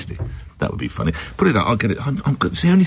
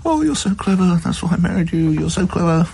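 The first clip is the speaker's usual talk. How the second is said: higher mocking wife voice, syrupy sarcasm; sarcastic wife; 9 semitones higher than usual. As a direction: drip fake admiration like a teasing wife. Wife mocking husband over Wordle.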